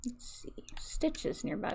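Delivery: soft unvoiced whisper to herself. Real, not acted. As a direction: whisper it under your breath. Muttering to herself while playing.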